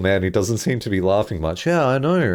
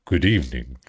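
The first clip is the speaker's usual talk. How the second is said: imitating deep voice; 10 semitones lower than usual. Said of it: "that's such a good voice".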